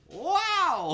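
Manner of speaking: very high, loud "wow"; hammed-up soundboard wow; mock amazement. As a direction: exclaim it with exaggerated wonder. Put-on amazement after joke.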